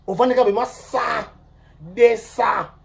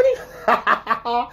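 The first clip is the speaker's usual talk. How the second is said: short burst of laughter; 3 semitones higher than usual. Real, not acted. Speaker mocking others to viewers, bursting out laughing.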